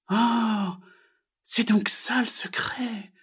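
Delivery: high, slow, hushed awe; little frog's drawn-out "oh" of discovery; wondering exclamation. A narrator's reading. Frog learns magpie's secret, high wondering voice. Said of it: "s'exclama Pigouille".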